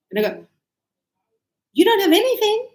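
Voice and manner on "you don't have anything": high, loud knowing statement; "You don't have anything" said high as a knowing statement; affirming negative tune. Shown as a demonstration. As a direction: state it as a confirmation, not a question. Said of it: "So here I'm doing the negative".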